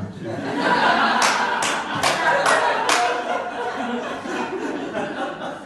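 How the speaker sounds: long airy laugh at himself; breathy laugh. Real, not acted. Laughing at how freaked out he was, airy laugh. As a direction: let out a rueful breathless laugh. Laughs at his own paranoia.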